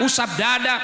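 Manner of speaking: ordinary talk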